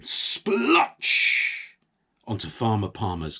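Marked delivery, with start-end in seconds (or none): 0.0-1.8 high whispered splotch; splotch sound effect. A narrator's reading. Narrator sounding the mess landing, whispered splotch. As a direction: spit out the splat like a sound effect.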